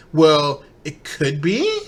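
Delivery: imitated Valley Girl lilt, BE swooping up high; high-pitched ending emphasis. Mocking his little phrases, high-pitched emphasis at the end. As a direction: start level on WELL, slide BE sharply upward. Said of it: "that high-pitched emphasis".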